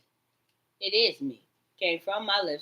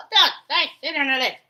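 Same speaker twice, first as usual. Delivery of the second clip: mock loud taunt; 9 semitones higher, 7 dB louder than usual. Mimicking the voices' taunt.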